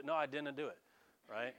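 ordinary delivery

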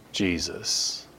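normal talking voice

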